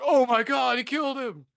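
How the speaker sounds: high, loud mock gasp of shock; hammed-up horror; shocked onlookers. Onlookers as Apollo dies, hammed-up shocked cry. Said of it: "they're like".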